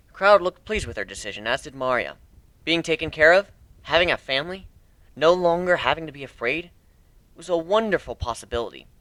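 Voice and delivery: ordinary delivery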